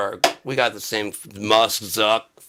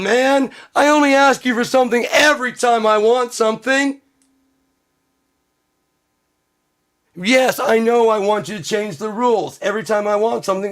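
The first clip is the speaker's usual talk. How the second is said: high, loud, whiny begging voice; whiny pleading; 10 semitones higher, 3 dB louder than usual. Acted. Rich man begging the court to change the rules, whiny pleading. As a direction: whine like a spoiled brat demanding everything.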